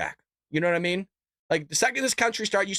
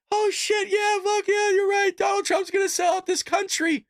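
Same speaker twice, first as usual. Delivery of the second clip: much higher, sneering mock of a gullible fan; mocking Democrat voice; 11 semitones higher than usual. Streamer mocking Democrats who swallow the line, high sneering parody. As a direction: ham up a dumb follower nodding along. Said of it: "they say something like".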